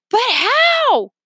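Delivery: high, loud desperate yell. A narrator's reading. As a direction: cry out in dangling panic. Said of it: "Leo yelled".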